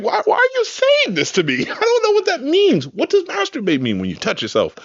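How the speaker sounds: put-on very high, bewildered kid voice; high-pitched mock-innocent child; eight-year-old child. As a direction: imitate a confused little kid asking questions. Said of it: "I was, like, eight".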